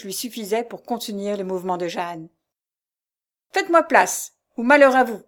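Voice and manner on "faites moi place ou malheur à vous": loud, raised, threatening order; angry threat. A narrator's reading. Abductor warns the man in his way, threatening.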